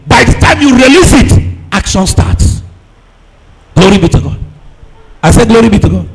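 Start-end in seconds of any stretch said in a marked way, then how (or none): none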